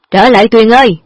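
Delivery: loud call to come back; father calling his daughter to come back; calling after her. Acted. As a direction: shout after the fleeing daughter. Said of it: "Mặc cho ông Lâm gọi".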